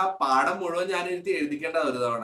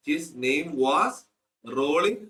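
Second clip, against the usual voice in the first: very slow reading aloud for notes; slow dictation; much slower than usual. Shown as a demonstration. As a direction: drag each word out for the class to copy. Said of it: "dictating text to class". (പതുക്കെ വായിച്ചു കൊടുക്കുന്നു)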